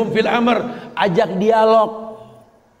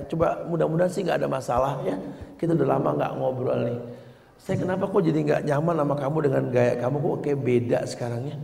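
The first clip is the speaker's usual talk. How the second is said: gentle questioning; 9 semitones lower, 7 dB quieter, faster than usual. Acted. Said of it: "sampai kita nggak nyaman pun bilang".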